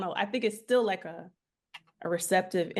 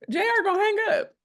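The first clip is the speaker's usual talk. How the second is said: excited protest; 11 semitones higher, 6 dB louder, faster than usual. Real, not acted. Laughing debate over Walter's voice.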